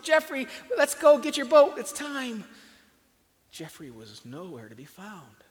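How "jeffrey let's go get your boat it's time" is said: high, loud happy call. A narrator's reading. Father calling his son to sail the boat, high and loud. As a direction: call to the boy through the house.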